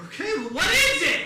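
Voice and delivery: high, loud impatient outburst; re-enacted exasperated shout; exasperated demand. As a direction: press her, losing patience. Exasperated son presses mother.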